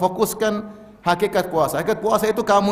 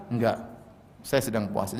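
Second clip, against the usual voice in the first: softer, unruffled decline; calm quiet refusal; 8 semitones lower, 9 dB quieter than usual. Retold or imitated. Preacher voicing a fasting believer who is being challenged to fight, softer calm decline. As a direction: turn the insult aside gently and quietly. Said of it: "kita bilang".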